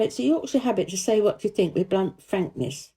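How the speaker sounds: plain reading voice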